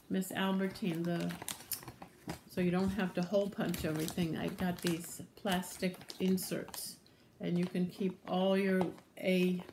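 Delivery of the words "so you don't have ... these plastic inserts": usual speaking voice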